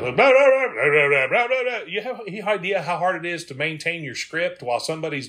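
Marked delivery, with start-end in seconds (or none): 0.0-1.8 high, loud mock jabbering; full-blast yammering. Granddaughter talking over the tour guide, full-blast yammering. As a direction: imitate non-stop loud chatter as nonsense. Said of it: "she talked with her grandmother full blast".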